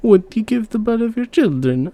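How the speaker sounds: a king posing the dreadful question; high, intense query; grave question. A narrator's reading. King asks the queen.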